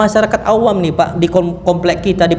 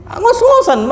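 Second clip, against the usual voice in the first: exclaimed; slower than usual. Retold or imitated. Imitating panting students.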